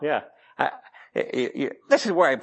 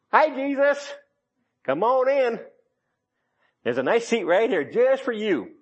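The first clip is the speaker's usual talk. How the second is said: high, sugary mock welcome; mock cheery host; 6 semitones higher, slower than usual. Preacher pretending to call Jesus into the boat, sugary. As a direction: invite him in sweetly to dodge the water.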